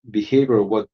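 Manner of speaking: ordinary talk, nothing marked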